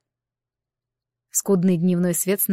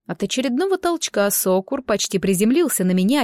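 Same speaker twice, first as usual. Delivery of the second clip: ordinary delivery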